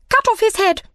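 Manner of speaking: high, loud shriek of a command; enraged queen shrieking her order at the court; furious royal shout. A narrator's reading. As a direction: scream the order at the whole court. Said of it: "cried the Queen".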